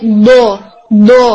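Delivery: دو held long, its sound coming from the back of the tongue; Arabic ض demo. Shown as a demonstration. Teacher demonstrating the letter ض to students, held heavy. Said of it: "sounds like".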